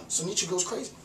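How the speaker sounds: ordinary talk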